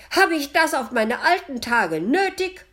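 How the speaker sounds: loud, high-pitched nagging shriek; screeching old woman. A narrator's reading. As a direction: scold shrilly at a shout. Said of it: "das Schreien und Keifen einer alten Frau". (keifende alte Frau, schreiend)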